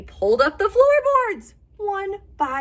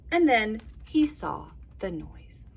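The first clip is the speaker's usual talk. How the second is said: ordinary delivery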